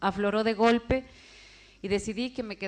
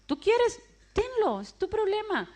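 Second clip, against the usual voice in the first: put-on the old guerrilla, pitched high and teasing; high mock voice; 12 semitones higher than usual. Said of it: "él me dijo algo".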